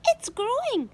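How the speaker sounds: high, loud amazed cry. A narrator's reading. Aunt Spiker crying that the peach grows. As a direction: shout the wonder out. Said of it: "Aunt Spiker cried".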